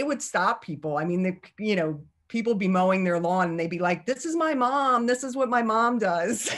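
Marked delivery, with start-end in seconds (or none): 4.0-6.5 put-on higher, excited kid voice calling out; imitating excited kids; kids proudly announcing. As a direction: proudly show off mom to the neighbor.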